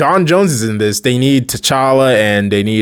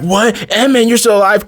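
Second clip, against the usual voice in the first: much higher, startled double-take; shocked disbelief; 9 semitones higher than usual. Retold or imitated. Teammates discovering Ant-Man survived, shocked. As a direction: gasp out the question in disbelief.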